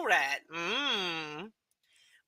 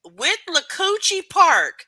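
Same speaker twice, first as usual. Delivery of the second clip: loud, slow, high stab at the name; 8 semitones higher, 8 dB louder, slower than usual. Real, not acted. Struggling with name.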